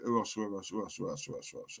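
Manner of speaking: playful fade from voice down to a whisper; fading to a whisper. Real, not acted. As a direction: let each who else die away to a whisper. Host muses while scrolling chat.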